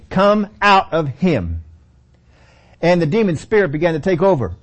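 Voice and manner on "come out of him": slow, higher, drawn-out order; deliberate command. Acted. Jesus begins commanding the demon spirit, slow deliberate command. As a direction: stretch each word as a command. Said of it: "He begins to say".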